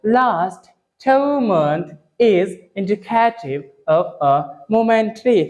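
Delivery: last two month read very slowly in an English manner; foreign-style reading. Shown as a demonstration. Teacher's final slow foreign-style read of the line. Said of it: "कैसे foreign के जैसे use करेंगे".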